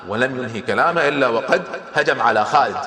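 usual speaking voice

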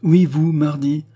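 high, loud, slow insistence; emphatic accusation. A narrator's reading. Charny accusing the queen, loud deliberate insistence. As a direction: drive each word home as an accusation.

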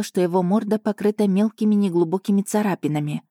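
plain reading voice

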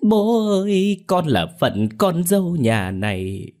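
high, hammed-up keening lament; daughter-in-law faking tears at the funeral; fake wailing. A narrator's reading. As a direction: overplay the grief for show. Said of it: "cũng ý ới nào là".

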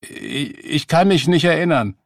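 high, loud, stumbling defence; flustered protest. A narrator's reading. Beggar cornered about his army service, flustered protest. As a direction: protest in a high voice, tripping at the start.

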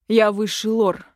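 loud, indignant protest; mildly flaring defiance; bristling. A narrator's reading. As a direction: bristle and assert your rank firmly. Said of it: "вскинулся отец".

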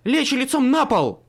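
резкий громкий окрик, выше; полицейский приказ во всю глотку; рявкнула. Acted. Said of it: "рявкнула Мёрфи". (громкий рявк)

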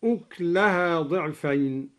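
أكلها said "uklaha", no vowel after k; vowelless kaf. Shown as a demonstration. Teacher demonstrating the reading, أكلها with silent kaf. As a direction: stop straight from k into l in أكلها. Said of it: "وسكن كاف أكلها هكذا".

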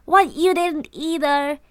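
put-on indignant child caught out; shouted. A narrator's reading. Twin girl yelling at her brother for tattling, offended shout. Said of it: "she shouted".

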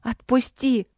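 high-pitched angry plea; sharp, upset demand to be freed; tearful anger. A narrator's reading. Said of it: "прошипела я, давясь слезами".

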